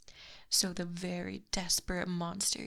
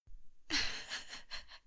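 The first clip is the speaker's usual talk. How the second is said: soft giggle; 5 dB quieter than usual. Acted. Lamia mocks captive's humiliation.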